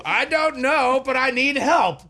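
very high, loud mock wail; panicked wife. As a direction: mimic a wife at peak panic. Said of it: "we go back and forth like this".

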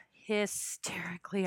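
soft unvoiced "Hysterically"; hushed whisper. Real, not acted. Repeats the word, hushed.

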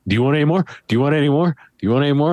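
ordinary talk

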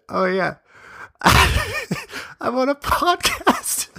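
high, slow, laughing through the words; cringe-laughing at his own reply; laughing retold answer. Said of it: "I said".

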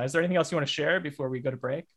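ordinary talk

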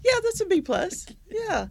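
high laughing verdict. Real, not acted. Grading the haiku, laughing out the verdict. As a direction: laugh out the grade.

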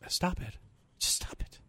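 soft, hushed, dismissive; hushed stop it. Acted. Host hushing a scary news article, softly. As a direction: shush the scaremonger quietly.

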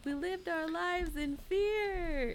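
high, slow, hushed mock-dramatic wail; imitating film character. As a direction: draw the movie line out in a high, soft voice. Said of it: "and he's like".

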